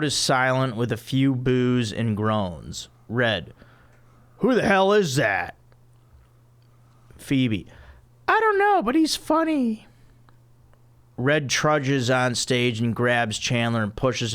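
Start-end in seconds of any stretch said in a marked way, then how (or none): 4.4-5.6 annoyed Red voice. Acted. 8.3-9.9 very high airhead voice; ditzy Phoebe voice. Acted.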